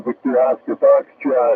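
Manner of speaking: ordinary talk, nothing marked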